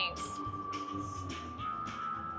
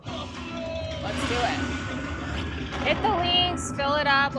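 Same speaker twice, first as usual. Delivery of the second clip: excited shout; 5 semitones higher, slower than usual. Real, not acted. Cheering on the bonus spins.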